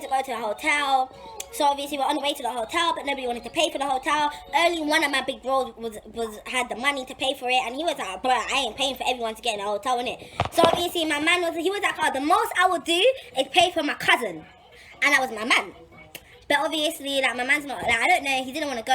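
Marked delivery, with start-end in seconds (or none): none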